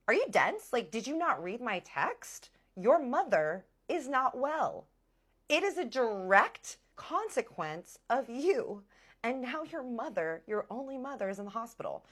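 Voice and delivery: high, loud, slow emphatic telling-off; acted outrage, drawn-out stressed words; exasperated scolding. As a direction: spell it out to someone not getting it. Acted scolding of uncaring boyfriend.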